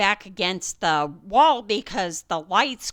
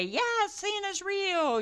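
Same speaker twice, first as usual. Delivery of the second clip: fake reassurance; 9 semitones higher, 6 dB quieter, slower than usual. Parent pretending to kids.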